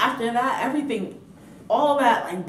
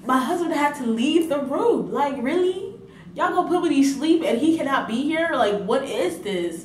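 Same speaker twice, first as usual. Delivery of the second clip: indignant; 7 semitones higher, 5 dB louder than usual. Real, not acted. Outraged at husband sent out.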